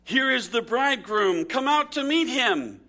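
mild herald's cry, read aloud; narrated cry. A narrator's reading. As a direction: call it out high, like news at midnight. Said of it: "at midnight there was a cry".

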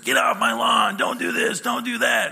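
put-on gruff shouted old-man yell; crotchety old man. Pastor mimics a crotchety old man yelling at kids. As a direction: bark at the kids like a grumpy old neighbor. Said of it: "that crotchety old man that just".

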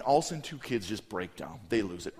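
normal talking voice

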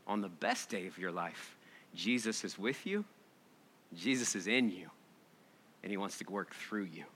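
soft hushed refrain. Shown as a demonstration. Preacher closing gently to the congregation, hushed and tender. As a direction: let it drop to a near-hush. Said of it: "repeated refrain softer".

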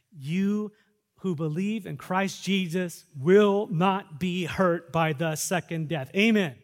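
loud, slow, emphatic proclamation; full-force preaching. Acted. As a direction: proclaim the promise slowly.

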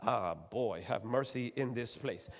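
usual speaking voice

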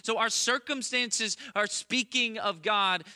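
high, loud fervent sermon voice; impassioned pulpit proclamation; preaching at full force. Real, not acted. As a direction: build it up like a preacher on fire.

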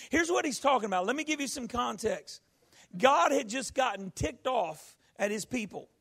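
ordinary delivery

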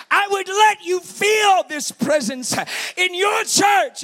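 high, slow preaching shout; soaring full-force cry; impassioned shout. Retold or imitated. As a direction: burst out loudly with God's promise. God's quote peaks in a shout.

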